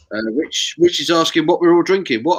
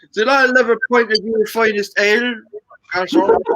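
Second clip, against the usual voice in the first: hammed-up put-on accent, last word jumping high; mock posh visitor accent; 6 semitones higher than usual. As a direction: play a tourist faking the local talk. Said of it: "We need an accent for Captain Meat's Adventures".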